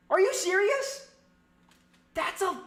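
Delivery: high, loud cry of disbelief; outraged disbelief. Real, not acted. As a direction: throw it out, outraged. Reacting to interception call.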